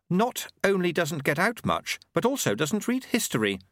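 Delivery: usual speaking voice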